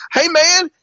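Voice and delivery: high-pitched mock holler. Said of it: "he's yelling at my friend Marcus".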